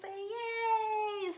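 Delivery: high bright cheer for the class; excited cheer. Real, not acted. Teacher cheering the class about spring, excited.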